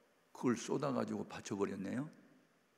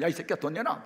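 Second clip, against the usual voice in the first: louder and higher, barking for the money; angry demand; 6 semitones higher, 6 dB louder than usual. Retold or imitated. Mother bursts into the pastor's office, loud angry demand. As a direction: storm in and demand it back.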